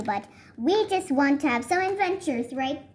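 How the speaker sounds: ordinary delivery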